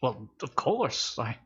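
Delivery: slow, drawn-out put-on shopkeeper; lightly voiced merchant stalling. Shopkeeper answering Rev's offer, slow put-on voice. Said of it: "he just goes".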